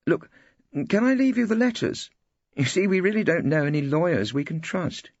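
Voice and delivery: a trusting wife asking for help; trusting young wife. Acted. Wife's voice read aloud.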